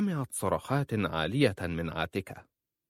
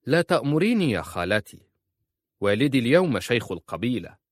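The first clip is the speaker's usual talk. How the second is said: louder, higher defiant retort; forceful defiance; 4 semitones higher, 7 dB louder than usual. A narrator's reading. Cousin defying her aunt's command, loud forceful defiance. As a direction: push back hard, refusing orders. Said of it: "فقالت عزة في قوة".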